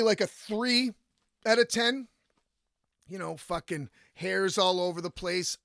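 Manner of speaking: ordinary delivery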